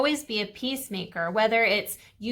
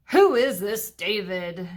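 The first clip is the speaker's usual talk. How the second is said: bellowed; 3 dB louder than usual. A narrator's reading. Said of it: "he bellowed".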